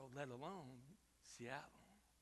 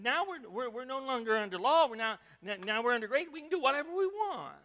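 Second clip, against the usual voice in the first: a touch of smug voice, rising higher at the end; mocking the careless; 6 semitones higher, 8 dB louder than usual. Pastor mimicking people who abuse grace, mildly smug. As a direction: lightly parody their excuse. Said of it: "some people who would think that".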